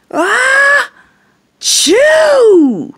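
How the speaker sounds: loud, high hammed-up sneeze; big sneeze. Bear sneezes loudly in the story, hammed-up. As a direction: build the ah then blast the chew.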